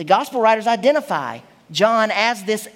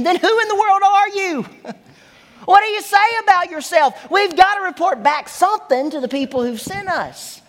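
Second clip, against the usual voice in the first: exasperated demand; 8 semitones higher than usual. Retold or imitated. Exasperated leaders demand an answer.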